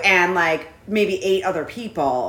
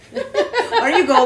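normal talking voice

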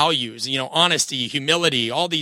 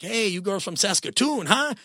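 super high-pitched impression voice; 6 semitones higher than usual. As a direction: imitate a high-voiced movie lifeguard. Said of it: "he has this super high-pitched voice".

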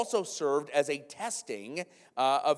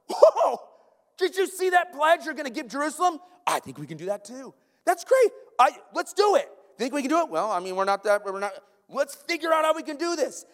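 much higher and louder, eager and gushing; excited Macedonians; 12 semitones higher, 6 dB louder than usual. Retold or imitated. Pastor voicing Macedonians eager to match a pledge, high excited gush. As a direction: gush like friends egging each other on. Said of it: "the Macedonians are like".

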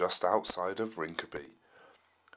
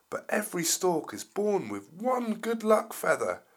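stork voice; 8 semitones higher, 6 dB louder than usual. Acted. Julian the stork speaks.

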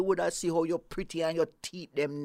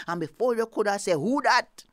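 loud burst; 9 dB louder than usual. A narrator's reading. Storyteller punches the exclamation.

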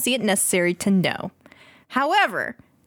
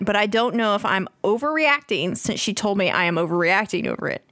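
usual speaking voice

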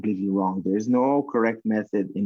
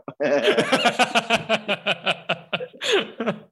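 long laugh; 13 semitones higher than usual. Real, not acted. Long laugh after story.

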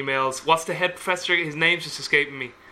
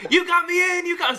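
elated shout. Retold or imitated. James thanks the friend on the phone, overjoyed. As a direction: gush your thanks at the top of your voice. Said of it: "And he was like".

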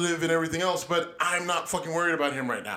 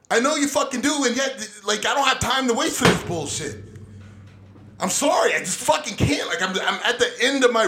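raised, higher and louder, fed up; venting in full frustration at the Democrats; exasperated rant; 5 semitones higher, 5 dB louder than usual. Real, not acted. Exasperated rant at a friend, raised and loud.

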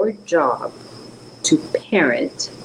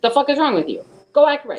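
scolding; 9 semitones higher, faster than usual. Pretend. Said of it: "when she scolds imaginary parents".

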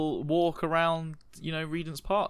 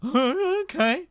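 high voice; 4 dB louder than usual. Acted. GM voices John.

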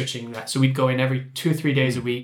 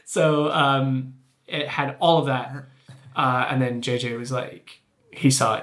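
usual speaking voice

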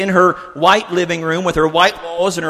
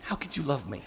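very hushed question to God; small, humbled plea; crushed disbelief; 2 semitones lower, 17 dB quieter than usual. Acted. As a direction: let the voice fall almost to nothing. Acted prayer, crushed question to God.

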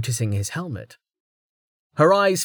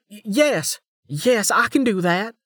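shaken stammer; 11 semitones higher than usual. A narrator's reading. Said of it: "staring at him in horror".

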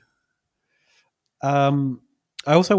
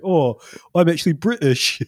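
higher, louder put-on British accent; hammed-up British voice, pitched up; mock British accent; 6 semitones higher, 5 dB louder than usual. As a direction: exaggerate a fake British accent. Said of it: "And her friend's like".

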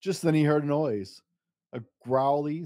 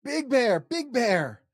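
squealing cub; 8 semitones higher, slower than usual. Acted. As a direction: squeal for help like a frightened cub. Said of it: "squealed Little Bear".

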